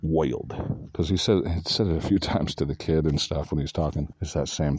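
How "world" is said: "Woild" with oi in place of the r vowel. Shown as a demonstration. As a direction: say "Woild" New York gangster style, vowel as oi. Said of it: "where you go O I".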